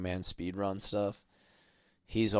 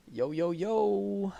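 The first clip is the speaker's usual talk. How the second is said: silly high voice; 10 semitones higher, much slower than usual. Pretend. Greeting in silly voice.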